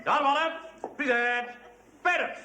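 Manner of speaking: barked command. Acted. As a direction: call it out across the hall. Officer drilling the guard of honour.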